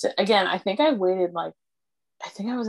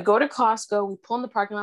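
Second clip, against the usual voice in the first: ordinary talk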